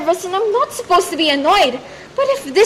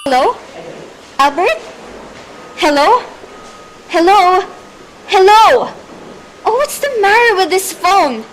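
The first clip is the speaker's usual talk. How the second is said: loud, repeated hellos into a dead line; calling into phone; 6 dB louder than usual. Acted. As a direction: shout down the phone, getting frantic.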